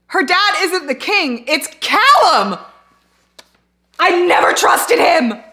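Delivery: loud, slow, high-pitched yell; outraged shouting. Real, not acted. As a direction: shout each word out in outrage. Outraged at plot reveal.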